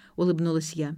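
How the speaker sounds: usual speaking voice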